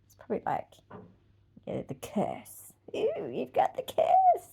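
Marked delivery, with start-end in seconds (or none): 2.9-4.5 high, slow, put-on squeal of disgust; mocking disgust. Taunting a girl about her period, in a high slow mock squeal. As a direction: sneer it like a grossed-out kid.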